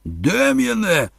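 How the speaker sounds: drawn-out, high shout; bellowing. A narrator's reading. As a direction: bellow the boy's name, stretching it. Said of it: "загорлав Торн". (горлаючи)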